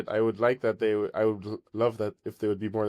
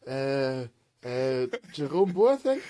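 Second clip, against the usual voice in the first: hesitant guessing; 3 semitones higher, much slower than usual. Retold or imitated. Football fan acting out his guess at a player's name, hesitant and slow. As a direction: draw out the name as an unsure question. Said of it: "I was like".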